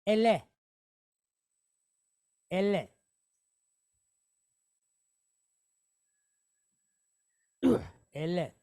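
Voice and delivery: Ele said as eh-leh with both vowels short; Hebrew word demo. Shown as a demonstration. Teacher models the Hebrew word Ele for the class, deliberate pronunciation. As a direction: repeat Ele carefully for the students to echo. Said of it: "So the word is".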